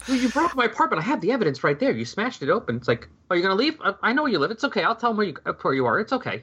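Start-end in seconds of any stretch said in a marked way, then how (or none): 0.1-2.8 high, breezy and unbothered; matter-of-fact. Retold or imitated. Imitating Diane coolly threatening Sam, matter-of-fact. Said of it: "matter-of-factly". 3.3-6.4 high, breezy and unbothered; matter-of-fact. Retold or imitated. Imitating Diane coolly threatening Sam, matter-of-fact. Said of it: "matter-of-factly".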